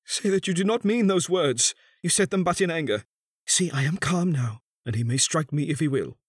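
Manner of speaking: high, pleading grief; paroxysm of grief. A narrator's reading. Son begs his mother to retract, grief-stricken. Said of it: "in a paroxysm of grief".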